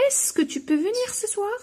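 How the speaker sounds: rising question tune; question intonation. Shown as a demonstration. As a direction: raise the pitch so it sounds like a question. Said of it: "نزيد est-ce que".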